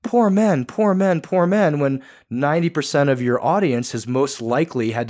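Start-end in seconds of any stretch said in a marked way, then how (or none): 0.0-1.8 high, louder whiny mockery of poor-me men; mock self-pity. Mocking men who play the victim, in a high, louder whine. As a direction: ham up a pitiful victim whine. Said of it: "I don't want this to be a podcast like".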